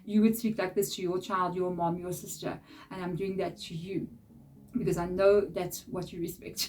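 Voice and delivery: normal talking voice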